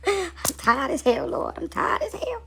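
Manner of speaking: slow mock-weeping prayer, rising high; hammed-up tearful weariness; crying exhaustion. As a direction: moan it to God, worn out. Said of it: "in the house crying like".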